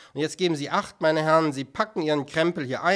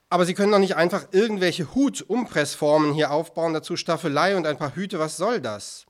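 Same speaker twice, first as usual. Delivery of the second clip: louder, indignant challenge; exasperated protest; 3 semitones higher, 7 dB louder than usual. Acted. Director challenges artists over hat moulds, exasperated. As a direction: protest as if at the end of patience.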